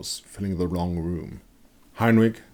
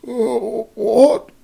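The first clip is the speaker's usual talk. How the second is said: much higher, groggy wounded man; dazed sick man waking, rising at the end; confused mutter; 8 dB louder than usual. A narrator's reading. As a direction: wake up bewildered. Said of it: "he muttered".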